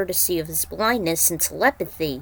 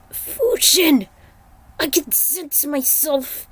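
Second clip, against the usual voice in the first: much higher, strained god voice, slowing at times; troubled thunder god; 6 semitones higher, 4 dB louder than usual. Acted. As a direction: speak like a shaken god confiding fear. Raiden voiced speaking to Fujin.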